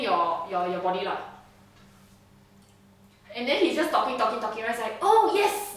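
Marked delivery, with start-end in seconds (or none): 5.0-5.7 high, loud burst of delight; delighted flourish. Acted. Examiner turns to prisoner, theatrically delighted. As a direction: break out brightly as if suddenly remembering. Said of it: "he turns around like this with a flourish".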